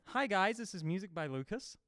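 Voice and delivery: high-pitched impression of a co-host; Lucas. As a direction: pitch it up for a mimicked channel intro, softening at the end. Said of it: "I can't quite get the tone".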